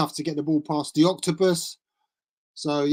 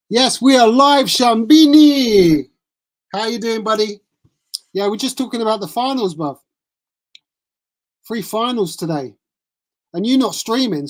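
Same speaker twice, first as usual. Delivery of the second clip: impression of Shambini; 4 semitones higher, 5 dB louder than usual. Streamer greeting his live chat, in a high, loud impression. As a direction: hype up the chat as an overexcited streamer. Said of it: "That's my impression of Ciambini".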